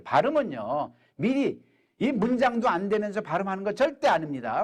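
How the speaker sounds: ordinary talk, nothing marked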